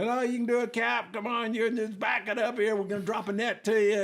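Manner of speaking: high-pitched imitated rig crew; calling out encouragement. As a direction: coax the captain from up on the rig.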